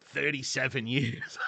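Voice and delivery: mock transplant admitting decades in LA; New Yorker reply.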